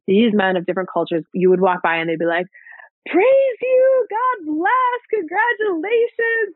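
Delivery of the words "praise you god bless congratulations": mock street blessing. Passing men greeting a pregnant woman, high and joyful. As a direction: sing out a blessing, drawn out on congratulations.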